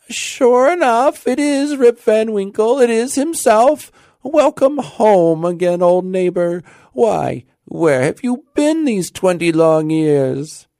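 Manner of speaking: put-on high, loud, slow old-woman voice; old woman exclaiming. A narrator's reading. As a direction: hail a long-lost neighbor in amazement.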